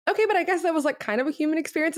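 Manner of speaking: high-pitched, hurried grudging concession; lightly mocked making-up voice; retold reconciling family. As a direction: pitch it way up, quick and placating. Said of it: "and then we're like".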